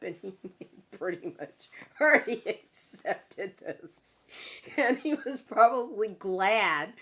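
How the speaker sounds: talking through a real laugh; amused, words broken up by laughter. Real, not acted. Laughs while recalling father's reaction.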